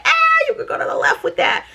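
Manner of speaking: high, put-on voice brushing off the price; mock dismissal. Shocked at fifteen hundred price.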